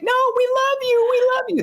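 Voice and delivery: very high, fast, sweet cooing; sugary high voice. Acted. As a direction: coo it like a creepy doll. Cheerful front faces.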